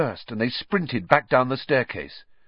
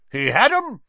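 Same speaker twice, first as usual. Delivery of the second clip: high, loud, triumphant announcement; proud; 11 semitones higher, 6 dB louder than usual. A narrator's reading. Portrait knight answers about the password, proudly. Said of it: "said Sir Cadogan proudly".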